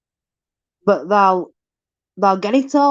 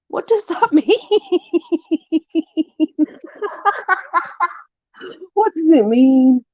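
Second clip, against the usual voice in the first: high, laughing question; laughing confusion; 8 semitones higher than usual. Real, not acted. Vlogger laughing at a nonsense saying, high and giggly. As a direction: laugh through the baffled question.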